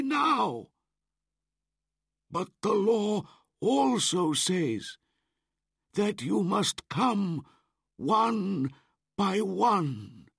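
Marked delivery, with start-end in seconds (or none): 2.3-10.3 high, loud, measured elder wolf; wolf pack law. Acted. Elder wolf reminding the pack, stern and slow. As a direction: lay down the law slowly, one word at a time.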